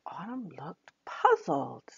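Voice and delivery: plain reading voice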